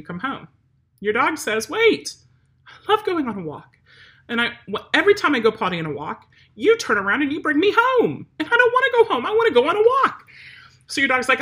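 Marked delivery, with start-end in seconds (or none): 1.7-10.2 high, loud pleading dog; whiny dog voice. Pretend. Trainer voicing the dog begging its owner for more walk, high and whiny. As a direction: beg like a dog who wants its walk.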